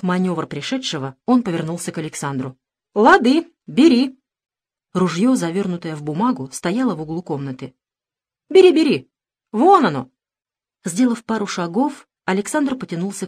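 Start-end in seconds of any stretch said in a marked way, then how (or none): 2.9-4.2 loud. Acted. Investigator tricking the brother. 8.5-10.1 loud. Acted. Urging him to take gun.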